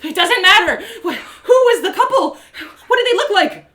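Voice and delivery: high, loud, urgent demand; frantic impatient yelling; exasperated shout. Acted. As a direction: cut in, desperate for the answer. Exasperated interrogation of rambling innkeep.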